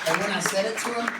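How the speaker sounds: normal talking voice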